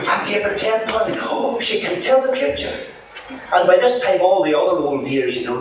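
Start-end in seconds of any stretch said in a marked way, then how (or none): 0.1-2.8 put-on high, matter-of-fact old woman's voice; old lady insisting. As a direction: repeat it as the stubborn old dear. Old lady repeats her boast.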